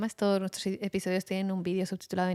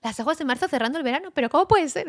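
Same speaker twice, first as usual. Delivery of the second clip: high, fast, louder disbelief; mock confusion, pitched up; puzzled disbelief; 7 semitones higher, 4 dB louder than usual. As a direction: rattle it off in baffled surprise. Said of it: "Es como".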